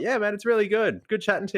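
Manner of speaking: high, upbeat matey reply; match his friendly energy; playing along. Tired shopper plays along with the teen, upbeat. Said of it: "I'm like".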